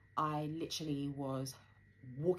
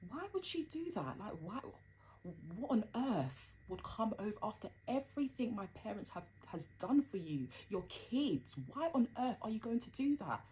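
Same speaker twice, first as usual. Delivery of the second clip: much higher, pleading why in disbelief; indignant disbelief; 7 semitones higher than usual. Retold or imitated. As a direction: ask it as if betrayed by family. Thinking in head, disbelief.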